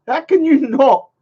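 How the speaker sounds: ordinary talk, nothing marked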